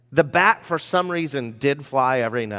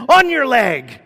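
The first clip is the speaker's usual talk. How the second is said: much higher, much louder sudden yell; mock scare shout; 10 semitones higher, 9 dB louder, slower than usual. Local guy pranking a frightened girl holding snakes, mock scare shout. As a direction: yell it suddenly, imitating a prankster's false alarm. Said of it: "and so he said".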